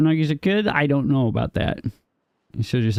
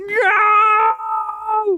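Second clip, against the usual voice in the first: high mock scream, long drawn-out NO; re-enacted middle-of-the-night scream; 3 dB louder than usual. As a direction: wail it out as a dramatic scream. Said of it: "just screaming".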